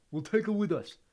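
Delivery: steady, loud resolve; firm. A narrator's reading. Neville firmly decides about Hermione. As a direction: settle it, no arguing. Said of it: "said Neville firmly".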